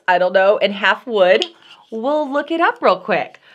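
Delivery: ordinary talk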